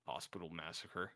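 low, hushed ominous announcement; title-card voice. Acted. Film title after the killing, low and ominous. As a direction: drop low and soft for the title.

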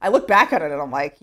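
normal talking voice

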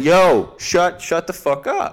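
loud, slow, drawn-out yell. Retold or imitated. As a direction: bark it at the rude parent. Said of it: "And I did it very loudly".

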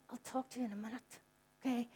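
quietly fob him off for now; hushed brush-off. Retold or imitated. Mother hushing her son mid-talk, quietly putting him off. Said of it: "and I went".

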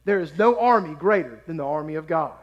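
normal talking voice

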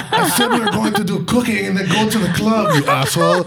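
very high, indignant mock-French; exasperated French-ish accent. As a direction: insist angrily in a fake French accent. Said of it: "what accent I'm doing right now".

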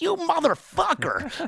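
high, slow, stunned blurted curse. Retold or imitated. Dozing passenger hearing the speed, stunned high blurt. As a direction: let it slip out in disbelief. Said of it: "I spit it out, like, out loud".

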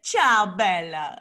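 hammed-up attempt at an Italian accent; mock Italian accent. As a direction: badly fake an Italian greeting, mocking it. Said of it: "trying to do fucking Italian and French accents".

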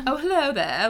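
a quick playful British accent greeting; mock British accent. Shown as a demonstration. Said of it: "like, a baseline British accent".

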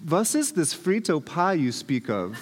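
comic put-on German accent. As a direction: ask as a puzzled German exchange student with an accent. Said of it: "with my German accent".

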